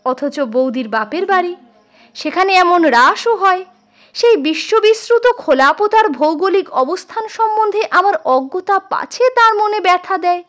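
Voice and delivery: ordinary delivery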